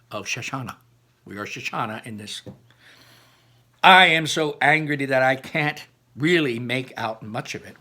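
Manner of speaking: ordinary delivery, nothing marked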